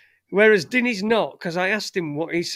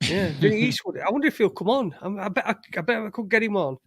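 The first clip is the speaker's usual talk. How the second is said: ordinary talk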